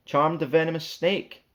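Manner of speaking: ordinary delivery, nothing marked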